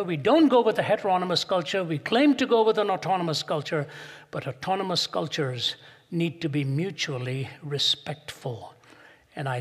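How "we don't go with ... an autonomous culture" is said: louder, higher, full preaching force; emphatic pulpit declaration; forceful preaching. Real, not acted. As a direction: drive it home to the congregation.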